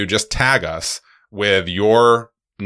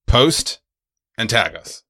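ordinary talk

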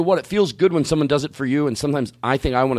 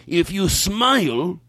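very slow, higher impression; imitated acquaintance's voice; 4 semitones higher, much slower than usual. Man quotes customer word for word, slow imitated voice. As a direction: draw each word out as him. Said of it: "I'm going word for word".

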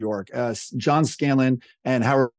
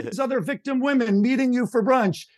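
high-pitched mock rant; exasperated yell, raised in pitch only; retold yelling; 9 semitones higher than usual. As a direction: imitate a boss insisting over the phone. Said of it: "like, yelling on his speakerphone".

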